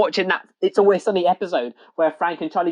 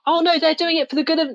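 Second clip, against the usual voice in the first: high-pitched mocking quote; mock naive; 10 semitones higher than usual. Imitating people who trust politicians, mock naive. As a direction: put on a high, naive protest.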